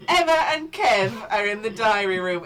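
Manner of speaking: attempted Geordie accent. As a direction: announce it in a rough Geordie accent. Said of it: "trying to do a Geordie accent".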